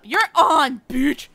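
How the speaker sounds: loud, brash "you're on"; shouted challenge. Acted. Player accepting a cooking duel, loud and brash. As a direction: fire back a challenge.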